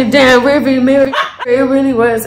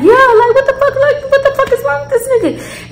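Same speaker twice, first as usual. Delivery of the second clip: much higher, laughing in disbelief; incredulous outburst; 12 semitones higher than usual. Retold or imitated. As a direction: squeal it, can't believe this guy.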